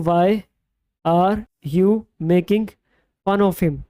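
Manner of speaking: very slow with pauses between word groups; slow, broken into chunks. Shown as a demonstration. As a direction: spell the sentence out piece by piece for students. (धीरे-धीरे, टुकड़ों में रुक-रुक कर)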